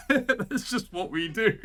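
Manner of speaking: high, slow, laughing through the words; amused, laugh-filled voice. Real, not acted. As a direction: say it helplessly through real laughter. Laughing at own country's habit.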